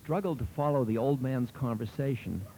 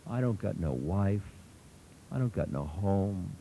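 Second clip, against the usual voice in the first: low, understated tearful voice; a touch of sobbing shame; mild sobbing; 4 semitones lower than usual. A narrator's reading. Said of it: "the big man began to sob".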